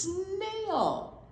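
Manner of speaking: usual speaking voice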